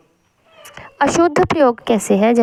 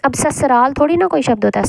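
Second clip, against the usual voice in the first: ordinary delivery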